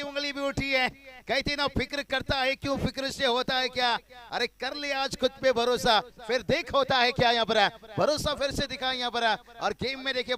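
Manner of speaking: loud, high wicket call with verse; excited cricket commentary. Acted. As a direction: belt out the run-out like a climax.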